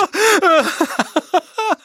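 high, mad nervous laughter; high-pitched hysterical cackle. A narrator's reading. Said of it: "se mit à rire nerveusement".

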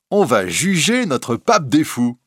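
high, loud cheeky schoolboy; a mischievous boy calling out; Jehan. A narrator's reading. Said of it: "dit Jehan Frollo". (voix de gamin espiègle, aiguë et forte)

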